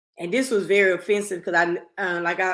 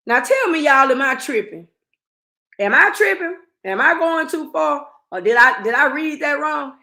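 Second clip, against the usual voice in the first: loud, high, worked-up outrage; indignant disbelief; 7 semitones higher, 7 dB louder than usual. Real, not acted. Asking her followers if she's overreacting, loud and high. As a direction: demand the viewers back her up.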